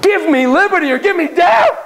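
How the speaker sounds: high, loud patriotic cry; rousing shout. Acted. Founding father rallying the colonists, loud rousing cry. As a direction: proclaim it like a rebel founder.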